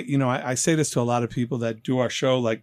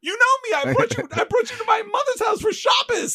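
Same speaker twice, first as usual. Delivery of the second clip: very high, protesting voice; hammed-up desperate excuse to a girlfriend; frantic pleading. Acting out pleading with departing girlfriend.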